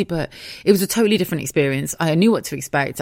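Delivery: ordinary talk, nothing marked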